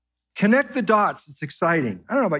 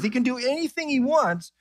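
usual speaking voice

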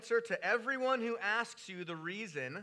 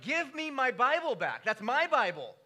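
louder, higher demanding plea; mock angry prayer; 5 semitones higher, 4 dB louder than usual. Preacher retelling his prayer to God, hammed-up indignation. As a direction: insist God give it back, exasperated. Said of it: "I said this to God".

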